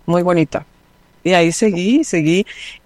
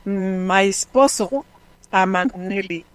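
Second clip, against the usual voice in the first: American lady's broken Spanish; slower than usual. Retold or imitated. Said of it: "en su español me dice".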